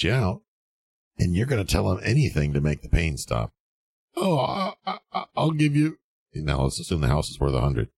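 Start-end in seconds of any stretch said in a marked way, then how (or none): 4.2-6.0 much higher, stammering mock panic; mock panicked stammer. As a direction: squeak it out like a cornered newbie giving in. Panicked newbie caving to seller.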